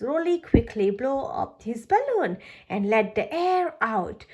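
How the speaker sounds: usual speaking voice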